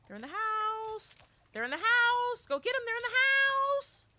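high, urgent singsong alert; alerting the family the survivors are inside; excited call. Real, not acted. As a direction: call it up to the team.

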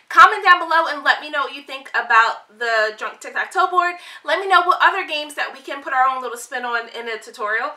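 bright, fast YouTube outro energy; vlogger. Acted. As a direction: pump it up like a vlogger signing off. Upbeat vlog outro to viewers.

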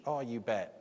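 ordinary talk, nothing marked

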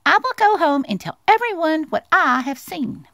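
high, eager mouse announcement; excited Little Mouse. Acted. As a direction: declare it as a thrilled little mouse. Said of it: "Little Mouse trembled with excitement".

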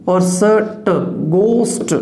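usual speaking voice

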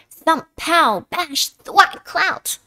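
punchy fight sounds. A narrator's reading. Fight sound words read out.

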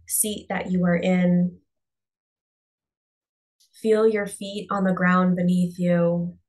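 calm, unhurried meditation voice with long pauses; guided meditation. Real, not acted. Coach guiding listeners to close their eyes and breathe, guided meditation. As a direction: ease them inward, leaving space after each phrase.

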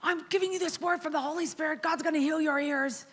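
high, eager prophetic announcement; excited declaration. Retold or imitated. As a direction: pitch it up with eager conviction. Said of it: "I was like".

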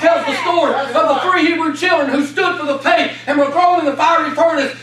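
preacher thundering out the fiery furnace story; fervent preaching. Acted. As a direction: build the Bible story to a shouted peak.

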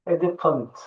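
elephant with a clear "f"; elephant, the f said clearly; clear f sound. Shown as a demonstration. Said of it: "Fı sesi var".

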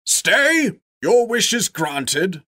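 high, loud commanding call; commanding wizard. A narrator's reading. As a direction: halt him with authority. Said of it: "said a voice".

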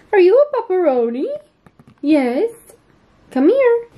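high sing-song voice for the dog; pet talk. Real, not acted. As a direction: coo at the dog brightly. Talking to her pet.

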